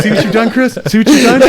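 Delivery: high laughing accusation. Real, not acted. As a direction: scold through laughter.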